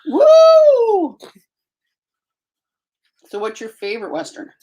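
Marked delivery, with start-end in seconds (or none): 0.1-1.2 high, loud joyful whoop; excited whoop. Real, not acted. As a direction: cheer it out like a fan. Cheering at wrath book packing.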